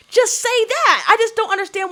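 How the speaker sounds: high, emphatic plea to be honest; exasperated demand. Real, not acted. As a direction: push it out high in frustration.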